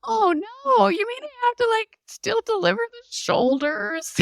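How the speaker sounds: high, loud mock-panicked squeal of disbelief; dismayed surprise. Dismayed retelling of birth moment.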